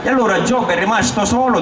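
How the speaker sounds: ordinary delivery, nothing marked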